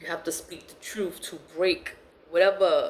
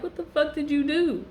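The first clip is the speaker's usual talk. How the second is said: high-pitched retold disbelief; incredulous retort; 7 semitones higher than usual. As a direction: pitch up, acting out a baffled challenge. Acted incredulous retort to her.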